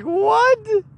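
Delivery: high-pitched retold "what"; acted astonishment; shocked disbelief. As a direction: recoil in disbelief, voice jumping up. Retelling own shocked reaction.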